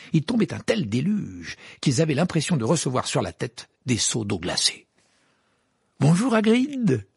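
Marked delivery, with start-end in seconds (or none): 6.0-7.1 called out loud and slow, much higher; Harry hailing Hagrid; shouted greeting. A narrator's reading. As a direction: call across the rainy platform. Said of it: "s'écria Harry".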